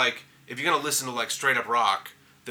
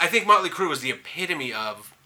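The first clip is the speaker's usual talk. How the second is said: ordinary delivery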